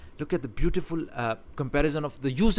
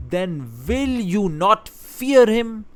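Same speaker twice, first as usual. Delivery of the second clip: "fear" drawn out high and loud, slow; stress on fear; 7 semitones higher, 7 dB louder, much slower than usual. Shown as a demonstration. A teacher showing the prophet's stressed warning to his nation. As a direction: stretch out "fear him" with rising force. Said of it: "Look at the stress".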